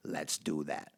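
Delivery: put-on low, soft, gravelly old-man grumble; curmudgeonly old man. Shown as a demonstration. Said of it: "like a curmudgeonly old man".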